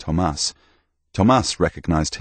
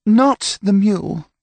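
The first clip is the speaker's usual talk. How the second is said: much higher and louder, slow and final; firm Delphine; 5 dB louder than usual. Acted. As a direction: lay down the law in a high voice. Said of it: "she said, and that put an end to it".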